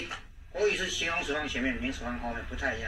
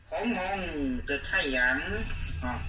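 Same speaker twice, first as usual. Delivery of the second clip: slow Mandarin example; much slower than usual. Shown as a demonstration. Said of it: "国语的话是".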